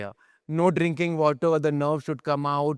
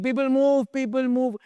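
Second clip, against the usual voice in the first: high, louder mock yell of warning; retold scream; 11 semitones higher, 3 dB louder than usual. Mahout warning the crowd, high mock yell. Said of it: "He's screaming".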